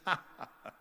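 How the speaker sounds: short imitated burst of laughter; mock laughing. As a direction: laugh like a dismissive agent hearing a joke. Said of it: "He started laughing".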